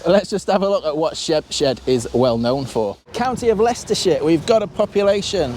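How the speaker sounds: usual speaking voice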